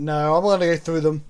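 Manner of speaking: ordinary talk, nothing marked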